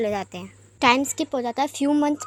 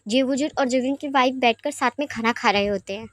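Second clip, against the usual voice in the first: usual speaking voice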